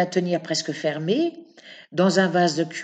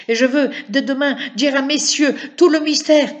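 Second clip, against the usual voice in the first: loud, higher, fast threat; crying out; 6 semitones higher, 6 dB louder, faster than usual. A narrator's reading. Eustache threatens to tell the judges, loud. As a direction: blurt it out loudly. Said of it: "s'écria Eustache".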